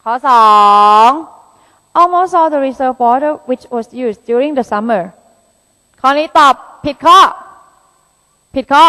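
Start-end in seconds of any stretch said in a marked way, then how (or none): 2.0-5.0 slow, careful reading of an exam sentence; slow deliberate English reading; reading aloud. Shown as a demonstration. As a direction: read the test item word by word, slowly.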